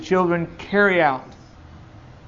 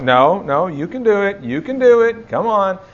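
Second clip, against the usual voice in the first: encouraging dad; 7 semitones higher, 6 dB louder than usual. Retold or imitated. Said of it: "And I'm like".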